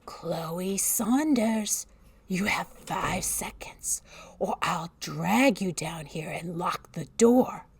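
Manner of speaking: slow, menacing whispered threat; threatening whisper. Acted. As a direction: spell out the threat slowly. Babysitter threatening child.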